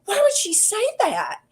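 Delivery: high-pitched, wounded protest; mock upset. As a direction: imitate someone who can't let an insult go. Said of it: "he's all upset about it".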